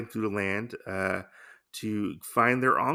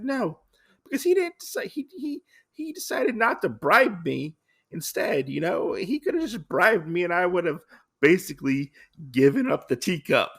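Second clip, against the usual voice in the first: high-pitched retold complaint; mock indignation; 7 semitones higher, 3 dB louder than usual. Gin grumbling to Usagi about not being bribed, mock indignation. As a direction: protest in a much higher voice, playfully aggrieved.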